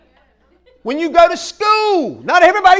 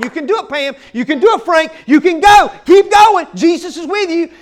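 rallying encouragement, building to a shout; cheering on; 3 semitones higher, 7 dB louder than usual. Acted. Church cheering on its struggling members, rousing encouragement. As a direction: urge them on like a sideline supporter. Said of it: "we're gonna cheer you on".

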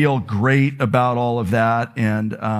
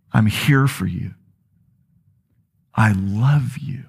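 tender divine voice; 4 semitones lower than usual. Acted. Jesus reassuring the striving congregation, tender and slow. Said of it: "the Spirit of Jesus would say that".

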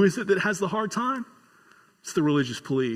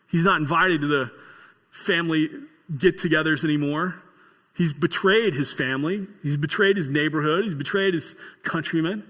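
usual speaking voice

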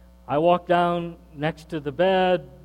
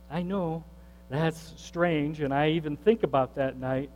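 normal talking voice

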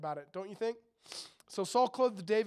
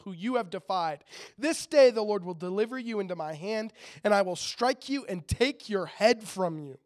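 a louder, earnest scripture reading; 5 dB louder than usual. Acted. Preacher reading David's challenge to the congregation, forcefully. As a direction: proclaim the passage to the room.

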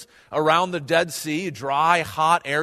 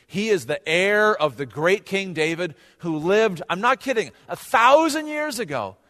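ordinary talk, nothing marked

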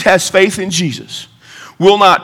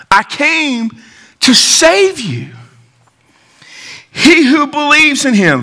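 emphatic preaching; 8 semitones higher than usual. Real, not acted. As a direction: proclaim it with long, weighted key words. Preacher declares scripture with force.

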